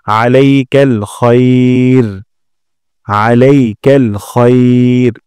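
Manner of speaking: আলাইকাল খৈর drawn one alif, then stop; slow recitation with pause. Shown as a demonstration. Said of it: "এক আলিফ টেনে পড়ব এবং ওয়াকফ করব". (এক আলিফ টেনে ওয়াকফ করে আলাইকাল খৈর)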